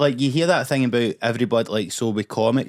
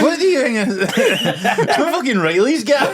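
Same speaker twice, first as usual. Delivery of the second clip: laughing; 12 semitones higher, 4 dB louder than usual. Real, not acted. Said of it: "laughing outburst".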